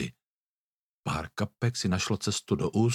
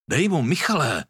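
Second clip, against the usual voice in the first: higher, louder cheering on the fight; egging on yell; 5 semitones higher, 3 dB louder than usual. A narrator's reading. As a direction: shout encouragement at a friend mid-brawl. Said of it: "pořvával Tobiáš". (pořvávání, povzbuzování)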